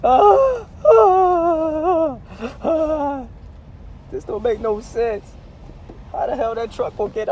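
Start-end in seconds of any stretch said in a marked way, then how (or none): 0.0-3.2 loud, very high drawn-out wails; hammed-up wailing sobs; mock crying wail. Driver stuck by the blocked road, mock crying wail. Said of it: "I'm about to cry".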